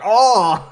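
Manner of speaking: loud, high mock death cry; mock cry of pain. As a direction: cry out in comic agony. Imitates priest as head gets twisted.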